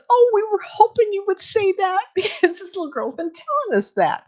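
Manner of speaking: the woman exclaiming her hopes came true; excited relief. Retold or imitated. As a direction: burst out with delighted relief. Relieved woman retelling child's claims.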